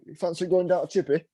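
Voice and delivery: light Yorkshire put-on; Yorkshire accent. Shown as a demonstration. Example of how Yorkshire lads invite you out, light Yorkshire accent. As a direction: slip into a casual Yorkshire accent. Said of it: "if we talk in Yorkshire accent".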